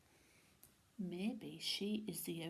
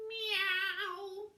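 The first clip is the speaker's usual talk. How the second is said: cat meow; 11 semitones higher, 9 dB louder than usual. A narrator's reading. Wilbur nods.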